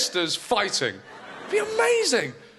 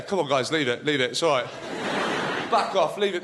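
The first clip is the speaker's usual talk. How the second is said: lower, steady peacemaker voice; Matt Damon calming; 6 semitones lower than usual. Retold or imitated. Matt Damon breaks up the fight outside the Oscars, low and calming. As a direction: talk the brawlers down low and calm. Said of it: "Matt Damon would be there".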